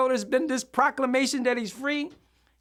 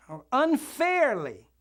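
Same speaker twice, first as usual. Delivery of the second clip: "unfairly" slow and raised in pitch; stress on unfairly; 4 semitones higher, slower than usual. Shown as a demonstration. Minister underlining a word in the definition, high drawn-out "unfairly". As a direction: lift and stretch "unfairly" to underline it. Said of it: "I'm gonna put an emphasis".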